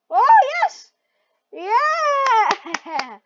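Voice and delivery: high, very loud victory yell; elated cheer. Real, not acted. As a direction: shout it in triumph. Celebrates success in game.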